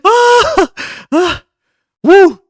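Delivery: loud high yelps ending in a cheering woo; excited whoops. Real, not acted. Streamer reacting to something in the game, loud excited whoops. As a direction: whoop out in sudden thrill.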